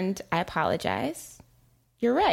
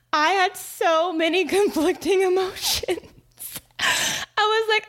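laughing; 12 semitones higher than usual. Real, not acted. Laughing while recalling kid's scolding.

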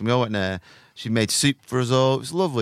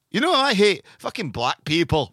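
hostess's outburst; 8 semitones higher, 3 dB louder than usual. Retold or imitated. Quoting the hostess's racist outburst.

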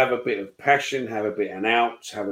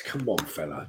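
ordinary delivery

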